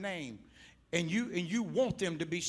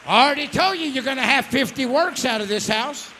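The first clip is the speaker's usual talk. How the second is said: loud, ringing preacher's cry rising higher; full-force preaching; 6 semitones higher, 15 dB louder than usual. Real, not acted. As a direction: preach it out with everything you have.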